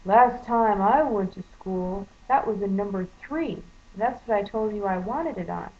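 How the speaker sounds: mock a customer talking down to a clerk; condescending. Customer corrects the clerk over the pump number, condescending. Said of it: "just the most condescending tone".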